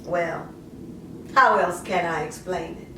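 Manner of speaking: lightly voiced mother justifying herself, conversational; poem in character. A mother explains giving up her daughter, lightly in character. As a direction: speak it as the mother, half confessing, half defending.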